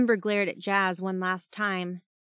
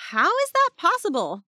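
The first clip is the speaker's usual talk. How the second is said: high, louder startled question; incredulous; 10 semitones higher, 5 dB louder than usual. A narrator's reading. Jazz messaging her teammate about the sighting, incredulous. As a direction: blurt it out, pitched well up.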